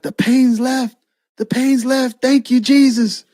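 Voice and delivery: high, overjoyed retold cry; praising God. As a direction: imitate a woman amazed at healing. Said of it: "she starts praising God".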